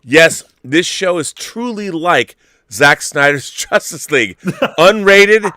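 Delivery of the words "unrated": higher, louder, building the pitch; excited hype. Real, not acted. Host hyping his show to the chat, excited and loud. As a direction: sell it like a trailer.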